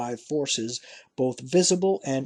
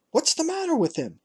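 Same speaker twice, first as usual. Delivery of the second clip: much higher, fast worried call; urgent question; faster than usual. Acted. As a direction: fire the question after him, high. Father shouts after rider.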